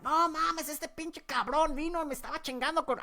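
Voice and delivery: high-pitched, rapid Spanish cursing; re-enacted high-pitched rant; animated cussing. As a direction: cuss away excitedly among cousins, voice way up. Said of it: "I was just cussing like crazy".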